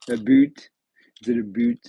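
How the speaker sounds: mock Canadian "about", said as "a boot"; Canadian accent. Shown as a demonstration. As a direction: try a joking Canadian "aboot", loud. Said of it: "have a pretty good Canadian accent".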